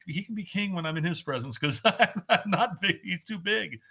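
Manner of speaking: high, laughing through the words; amused, self-mocking chuckle while quoting himself; giggling aside about feeling small; laughing quote. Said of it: "and it was like".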